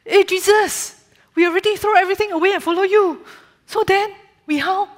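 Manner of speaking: high, fast, pushy Singlish voice; Singlish Peter voice. Acted. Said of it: "this story is going to be in Singlish".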